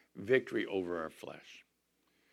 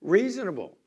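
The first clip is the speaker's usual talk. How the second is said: Reasonable said higher and louder, punched; stress on Reasonable; 6 semitones higher, 6 dB louder than usual. Shown as a demonstration. Preacher underlining the key word for the church, stressed. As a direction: hit the word Reasonable hard.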